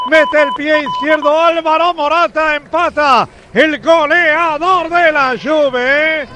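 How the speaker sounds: loud, high goal call; goal commentary. Real, not acted. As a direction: call the equalizer at full voice. Live goal call on radio.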